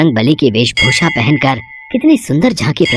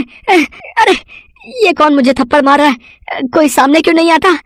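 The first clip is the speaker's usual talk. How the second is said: very high, fast frantic yelps; shrill terrified babble; panicked shriek; 12 semitones higher than usual. Acted. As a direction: cry out wildly at an unseen attacker. Unseen attacker keeps slapping.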